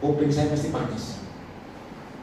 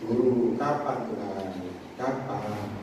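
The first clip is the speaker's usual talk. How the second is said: low, soft deflated plea; storm-hit believer; 6 semitones lower, 6 dB quieter, much slower than usual. Retold or imitated. Believer hit by the storm asking the Teacher when, low deflated plea. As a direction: let it sink quietly, word by word. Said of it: "kena badai, saya ngomong gini".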